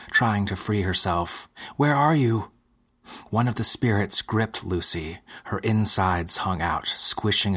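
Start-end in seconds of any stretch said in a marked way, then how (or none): 1.8-2.5 higher, louder desperate call; crying out. Acted. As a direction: call out searching for him. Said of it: "she cried".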